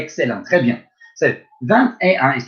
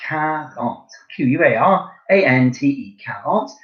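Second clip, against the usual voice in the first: usual speaking voice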